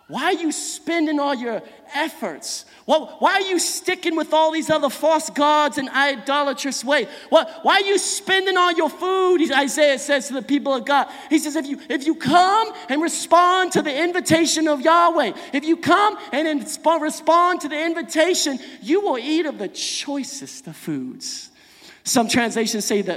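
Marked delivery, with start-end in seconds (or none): 0.1-9.4 high, impassioned preacher's questioning; urgent challenge. Acted. Isaiah challenging the people of God, urgent and raised. 11.6-21.5 high, loud preacher's plea, easing at the end; urgent appeal. Acted. Isaiah inviting the people of God, impassioned appeal.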